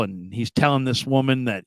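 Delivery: ordinary delivery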